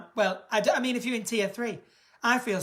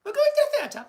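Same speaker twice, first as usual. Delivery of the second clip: high, plummy royal voice calling Philip; posh Queen voice; 13 semitones higher, 3 dB louder than usual. Pretend. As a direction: play the Queen summoning her husband. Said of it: "I don't know why I'm talking like that".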